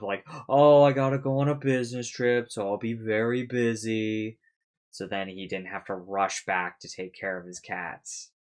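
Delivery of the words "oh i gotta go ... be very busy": fake excuse. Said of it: "making the excuse of".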